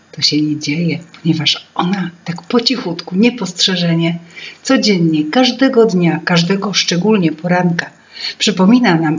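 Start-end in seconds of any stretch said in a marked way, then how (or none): none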